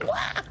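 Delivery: very high, whispery little "wah"; playful wah. Pretend. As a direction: toss out a tiny high-pitched squeal of play. Demonstrates homemade toys for kittens.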